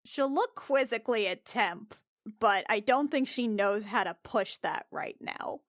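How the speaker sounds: usual speaking voice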